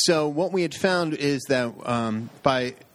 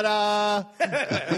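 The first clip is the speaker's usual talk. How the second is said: mock ta-da; 9 semitones higher than usual. Mock presentation after joke.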